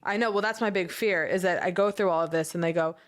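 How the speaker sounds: ordinary talk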